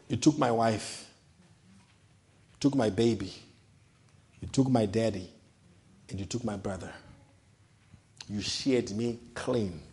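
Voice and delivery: hushed imitation of a weeping prophet; tearful prophet. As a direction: mourn each loss quietly, near tears. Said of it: "the prophet was crying when he was saying these words".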